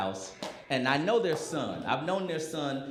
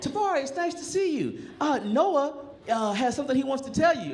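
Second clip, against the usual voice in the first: much higher, prim imitation of the friends; imitating friends; 11 semitones higher than usual. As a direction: put on a sweet, high host voice. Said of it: "And my friends were like".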